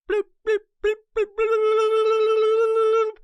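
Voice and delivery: high-pitched bloop notification noises. Retold or imitated. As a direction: mimic a phone pinging nonstop. Imitating phone notification sounds.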